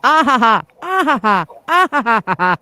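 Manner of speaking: high, loud repeated ha-ha cackle. Real, not acted. Cackling at the joke.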